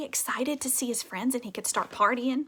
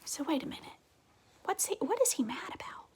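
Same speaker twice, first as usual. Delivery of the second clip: soft whispered question; whispering; 4 semitones higher, 5 dB quieter than usual. Real, not acted. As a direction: whisper it with curiosity.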